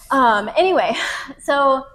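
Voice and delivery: usual speaking voice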